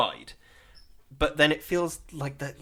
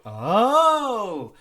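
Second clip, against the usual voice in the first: mock surprise; 7 semitones higher, 4 dB louder than usual. Realising the twist pays off.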